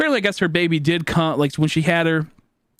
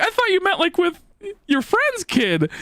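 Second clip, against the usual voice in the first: high confused protest; 11 semitones higher than usual. Retold or imitated. Retelling confused reply to friend.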